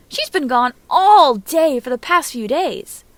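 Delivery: loud, slow whine, stretching out all; whiny drawn-out complaint. Acted. Naruto tattling to his sensei, stretched whiny complaint. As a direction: drag out the word all like a sulky kid. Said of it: "Naruto elongates the ah sound when he says all".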